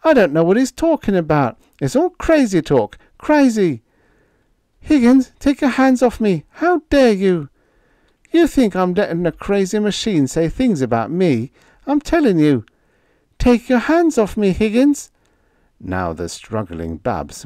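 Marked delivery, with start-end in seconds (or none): 0.0-3.8 fast, high and louder, rattled; panicked babbling. A narrator's reading. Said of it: "In a panic". 4.8-7.5 high and loud, outraged; indignant. A narrator's reading. Babs seized by Higgins. 8.3-12.6 higher and louder, pressing hard; desperate insisting. A narrator's reading. Higgins struggling with Babs. 13.4-15.1 high and loud, strained; frightened demand. A narrator's reading. Babs struggling and frightened.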